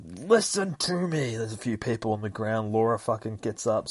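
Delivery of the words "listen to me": high, slow pained plea; strained plea. Retold or imitated. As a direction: gasp it out while wounded. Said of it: "it's like".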